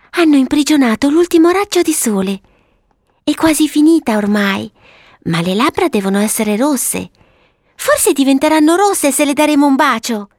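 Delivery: put-on high, girlish explaining voice; little girl Violetta. Violetta explaining the snow girl's colours, high child voice. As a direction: play a child reasoning earnestly.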